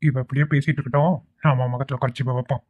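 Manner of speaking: hushed conspiratorial aside; whispered plotting. Acted. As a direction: keep it low so she can't hear. Kidnappers plotting behind her back.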